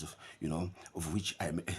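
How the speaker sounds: usual speaking voice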